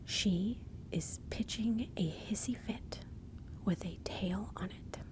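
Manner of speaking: slow, deliberate enunciation of the saying; slow, spelled-out repeat. Shown as a demonstration. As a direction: lay it out slowly, phrase by phrase. Same saying repeated slowly, word by word.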